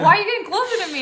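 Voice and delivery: high, loud comic outrage; indignant crocodile. Acted. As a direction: yell it as an affronted crocodile, half laughing. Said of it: "and it's like".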